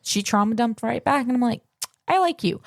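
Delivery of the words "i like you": retold high, perky approval; high-pitched approval. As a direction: lift it high as if taking to someone. Retold friendly quote to new friend.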